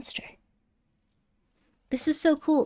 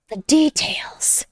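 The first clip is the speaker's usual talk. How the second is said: The details whispered slowly, much higher, rising on The; 5 semitones higher, 6 dB louder, much slower than usual. Shown as a demonstration. Marveling at the figure's details, higher whisper. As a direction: lift The details up in a slow breathy whisper.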